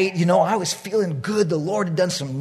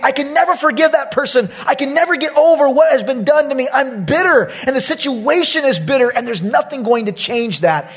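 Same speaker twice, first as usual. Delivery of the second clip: raised, high, insistent voice; bitter protest; 7 semitones higher, 3 dB louder than usual. Retold or imitated. Wronged believer refusing to forgive, bitterly insistent. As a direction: act out stubborn resentment. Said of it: "And we think".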